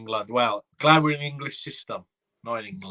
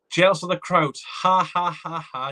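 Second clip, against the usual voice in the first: higher, scornful ha-ha at the rival fan; mocking laugh; 5 semitones higher, slower than usual. Real, not acted. Streamer mocking a rival fan's comment, scornful laugh. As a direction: laugh him off with mock scorn.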